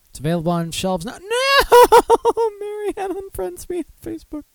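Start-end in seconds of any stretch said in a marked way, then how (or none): 1.2-2.6 high, loud drawn-out "no"; mock anguished wail. Host learning a friend unfriended him, mock wail. 2.6-4.4 very high, soft pretend-crying whine; mock wailing. Host unfriended on Facebook by Marianne, mock tearful whine.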